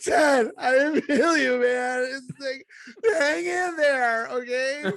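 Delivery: high, slow mock-tender voice to dad; hammed-up tearful comfort. Podcaster comforting dad in a mock-weepy voice. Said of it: "I'm like".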